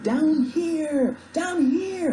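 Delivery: high, loud shout up at a plane; high-pitched cry for rescue; desperate yell. Acted. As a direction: yell to be seen from far off. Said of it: "I yelled".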